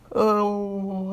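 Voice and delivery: yawning mid-word. Real, not acted. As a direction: let a yawn stretch the word. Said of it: "I'm keep on yawning".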